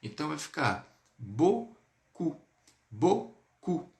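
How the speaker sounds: "beaucoup" said bo-ku, the final p silent; demo beaucoup. Shown as a demonstration. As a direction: slowly model "beaucoup" with no p. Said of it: "ele sempre dá som de U". ("beaucoup" devagar, sem o p)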